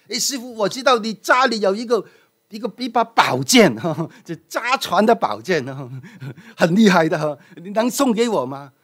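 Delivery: put-on a young student begging excitedly for the sword, with a laugh; eager disciple. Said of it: "马上跑到师傅面前说".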